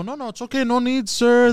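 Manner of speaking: high-pitched mock-panicked phone worker. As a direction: placate the caller in a high fluster. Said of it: "Oh, the panic on the".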